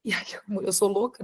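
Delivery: ordinary delivery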